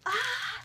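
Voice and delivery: high, loud excited squeal; happy squeal. Retold or imitated. Fan reaching the soundboard, retold happy squeal. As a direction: let out a short fangirl shriek. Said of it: "I'm just so happy".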